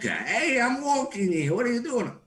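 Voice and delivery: loud, high-pitched New York yell; New York accent. Shown as a demonstration. Performer switching impressions for the hosts, loud New York accent. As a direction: holler like an indignant New Yorker. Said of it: "then you go to New York".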